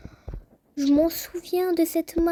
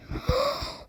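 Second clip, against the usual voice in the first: high-pitched shocked gasp; sharp gasp; 10 semitones higher, 7 dB quieter than usual. Real, not acted. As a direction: suck in a quick, stunned breath. Awed reaction to the game.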